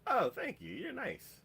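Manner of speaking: high, light imitation of a boy's thanks; high-voiced, simple gratitude; naive thanks. Retelling the boy's sweet, simple reply.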